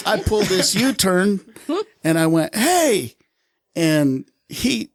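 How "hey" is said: high-pitched retold "hey" after someone; mock holler, pitched high; calling out. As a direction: call after someone running off. Officer calls out to fleeing son.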